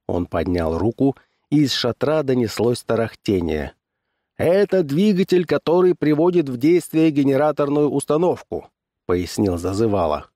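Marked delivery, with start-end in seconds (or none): 4.4-8.7 high-pitched barker's patter; fairground barker. A narrator's reading. Barker selling his show to the crowd, showman's patter.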